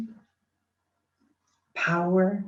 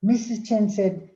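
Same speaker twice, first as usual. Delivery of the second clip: ordinary talk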